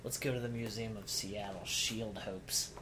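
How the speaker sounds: ordinary talk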